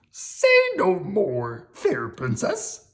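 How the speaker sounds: slow, gallant little monster; Grover voice. Acted. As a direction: Grover playing the noble knight.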